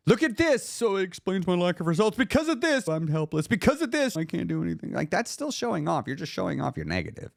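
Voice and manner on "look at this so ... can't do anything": high whiny put-on voice, dropping at each clause end; mock helpless whine. Speaker mocking people who show off helplessness, whiny voice. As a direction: parody a helpless excuse-maker.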